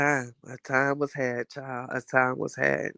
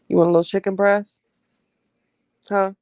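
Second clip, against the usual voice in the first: higher, louder, quick coaxing voice for the dog; pet talk to dog; 6 semitones higher, 6 dB louder, faster than usual. Real, not acted. Offering her dog a treat.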